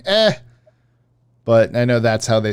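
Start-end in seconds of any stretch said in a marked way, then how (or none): 0.0-0.3 "Eh" as a short clipped grunt; just go "Eh"; sounding out eh. Shown as a demonstration. Explaining how the spelled A reads, a mock "Eh". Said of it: "you're just going".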